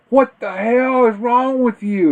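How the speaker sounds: high, loud, slow mock outrage; hammed-up shocked yell at the brother; shocked outburst. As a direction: blurt it out in disbelief, way up high. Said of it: "I suddenly went like this".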